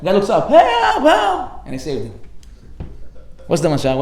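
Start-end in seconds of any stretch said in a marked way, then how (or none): 0.5-1.5 high, loud cries of help; shouting for help. Retold or imitated. Stranded man shouting to a passerby, high and loud. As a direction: shout down so the man below hears.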